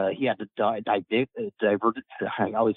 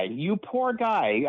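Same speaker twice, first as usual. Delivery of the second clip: slow, high, drawn-out mock sympathy; mock pity; 7 semitones higher, much slower than usual. A friend teasing a colonoscopy patient with mock pity. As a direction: stretch out the poor guy in a higher tone.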